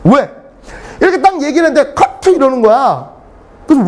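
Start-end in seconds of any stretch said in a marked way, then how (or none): 1.9-2.3 high, abrupt mimicked "cut" call; director calling cut. Director halting the lecturer mid-recording, mimicked high call. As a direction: interrupt sharply, pitched well up.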